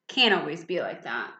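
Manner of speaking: usual speaking voice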